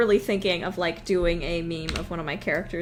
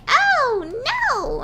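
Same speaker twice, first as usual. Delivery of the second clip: much higher, loud squeal; annoying high-pitched; 5 dB louder than usual. Retold or imitated. Imitating the Barbie movie villain's annoying high squeal. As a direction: shriek it, annoying and piercing. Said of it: "really annoying and high-pitched".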